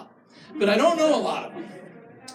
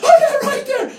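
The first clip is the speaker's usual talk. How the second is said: high, loud mock ecstasy; hammed-up ecstatic shout; 7 dB louder than usual. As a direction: cry it out like she's overwhelmed with pleasure. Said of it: "she's like".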